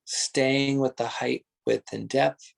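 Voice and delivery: soft, unhurried meditation guidance; guided meditation. Acted. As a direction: lead them gently inward, taking your time.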